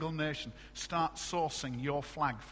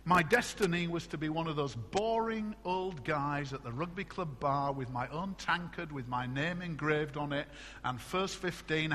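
ordinary talk, nothing marked

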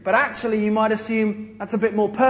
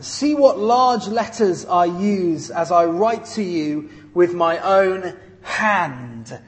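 scripture reading; 4 dB louder, slower than usual. Shown as a demonstration. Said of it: "Let's stop there straight away".

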